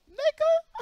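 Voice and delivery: a sudden high squeal of disbelief; outraged disbelief. Retold or imitated. To Charles offering fifty dollars, a high outraged yelp.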